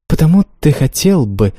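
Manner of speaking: a sly smiling guess. Acted. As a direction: guess his secret with a playful smirk. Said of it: "она лукаво улыбнулась".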